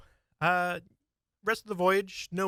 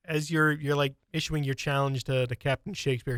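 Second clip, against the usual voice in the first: ordinary talk, nothing marked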